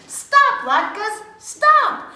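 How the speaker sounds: loud high call to stop. A narrator's reading. Rebecca chasing her party latkes, loud high call. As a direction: shout after them as they roll away.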